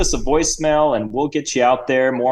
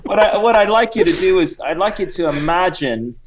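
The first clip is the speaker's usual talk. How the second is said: higher put-on Cockney-Australian accent; Sir Reginald; 6 semitones higher than usual. Guest asks the hosts to imagine, in high fake Cockney-Australian accent. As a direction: set the scene in high Cockney-Aussie. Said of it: "bit of a Cockney accent and Australian".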